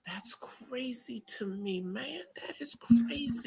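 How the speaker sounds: hushed, stunned whisper; whispered disbelief. Real, not acted.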